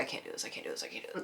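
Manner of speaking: breathless whisper, I can't do this; whispered panic. Retold or imitated. Fan seconds from meeting her hero, whispered dread. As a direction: whisper it in terror. About to meet idol, nervous.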